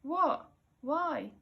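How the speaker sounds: yelling questions up a rock face; confused call. A narrator's reading. Confused call to the boy above.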